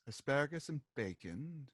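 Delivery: low, quiet party guest; hushed low-voiced witness; puzzled witness. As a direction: answer softly and low, puzzled.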